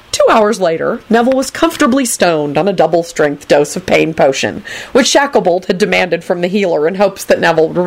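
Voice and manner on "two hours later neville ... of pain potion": ordinary delivery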